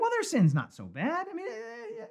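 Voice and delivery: mock someone brushing off a sin; excusing whine. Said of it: "It's like".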